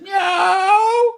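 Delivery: very high, loud meow; a loud high cat's cry. Acted. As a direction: answer like a cat. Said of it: "Simpkin again said".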